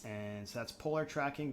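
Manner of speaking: ordinary delivery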